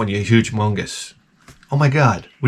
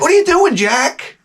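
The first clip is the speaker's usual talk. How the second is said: alarmed outburst; 12 semitones higher than usual. Retold or imitated. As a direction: squeal at the stranger in your engine. Retold alarm at mechanic.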